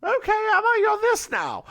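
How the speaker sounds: put-on high, loud old lady voice while laughing at herself; staying in the voice. Speaker comments on her own act, still in old lady voice. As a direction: stay in character despite yourself. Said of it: "why am I doing this".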